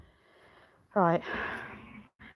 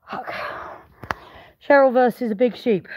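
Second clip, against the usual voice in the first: ordinary delivery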